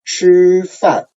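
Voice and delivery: high level 吃, plunging 饭; first tone plus fourth tone. Shown as a demonstration. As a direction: keep 吃 up high, then let 饭 fall suddenly. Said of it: "there's a suddenly down".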